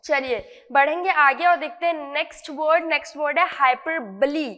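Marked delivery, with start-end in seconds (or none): none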